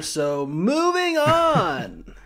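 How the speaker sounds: high, slow sing-song move on; dismissive. Acted. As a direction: drag it out mockingly. Dismissive topic change.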